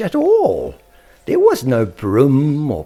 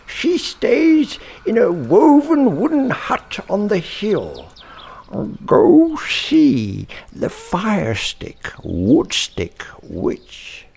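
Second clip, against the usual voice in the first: a much higher, airy voice giving directions; high character voice; 13 semitones higher, 4 dB louder, slower than usual. Acted. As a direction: speak high, advising the way to the witch. Quoted advice voiced as a character.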